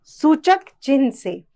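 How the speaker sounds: ordinary delivery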